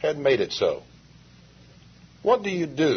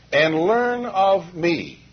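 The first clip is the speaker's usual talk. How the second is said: slow, weighty stress landing on "me"; 5 semitones higher, much slower than usual. Shown as a demonstration. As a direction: lean hard on the word "me" in "learn of me". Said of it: "I emphasize the word "me" in there very heavily".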